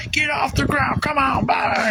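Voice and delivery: high-pitched motivational shout; acted coach's holler, pushed way up in pitch; yelling coach. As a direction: yell at your team like a coach mid-game. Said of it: "Coaches yell at you".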